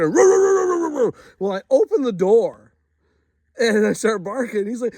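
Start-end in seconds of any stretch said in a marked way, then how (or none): 0.0-1.1 high, loud mock beast roars; animal roar. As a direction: roar like a wild animal to scare a friend. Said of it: "still barking".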